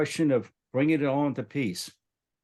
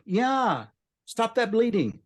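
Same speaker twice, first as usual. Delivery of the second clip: put-on gentle voice to a child; 10 semitones higher, slower than usual. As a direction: coax a frightened child. Coaxing the girl to stop bleeding.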